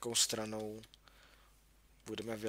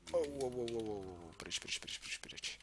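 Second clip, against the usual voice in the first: hurried panic. Real, not acted. Fleeing a mob in panic.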